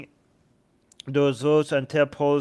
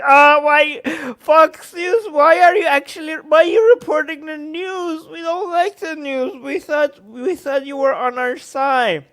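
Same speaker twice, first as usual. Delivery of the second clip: high, whining mock plea with a cry in it; mock whiny supporters; 9 semitones higher than usual. Streamer mocking Trump fans scolding Fox, high whiny plea. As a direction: whine like a betrayed fan.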